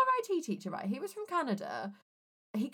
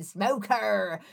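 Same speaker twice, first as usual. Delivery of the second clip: drawn-out teasing call; long, mildly raised taunting shout; mock shout; 4 dB louder than usual. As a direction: call it out like kids at a door. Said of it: "bang on the door shouting".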